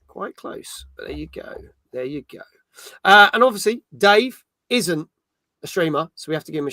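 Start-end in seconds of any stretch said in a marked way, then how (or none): 0.1-0.8 whispered. Real, not acted. 0.9-2.4 whispered. Real, not acted. Repeating softly to self.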